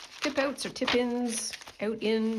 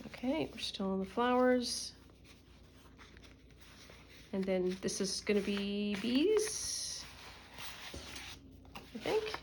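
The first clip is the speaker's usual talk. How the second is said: usual speaking voice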